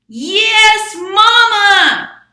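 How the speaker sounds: very high, very loud sung-out squeal; loud mouse. Acted. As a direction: belt it like a mouse who cannot be quiet. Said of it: "That loud mouse just could not use a quiet voice".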